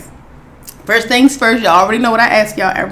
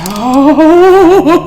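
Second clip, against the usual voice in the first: long rising "oh" building to a shout; excited whoop; 4 semitones higher, 5 dB louder than usual. Real, not acted. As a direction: swell from "oh" into a loud, high whoop. Overcome with excitement over crab.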